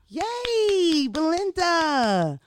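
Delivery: high, loud, drawn-out cheer; excited cheer. Real, not acted. Host cheering the twerk contest winner, high and loud. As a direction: cheer the winner's name, stretching it out.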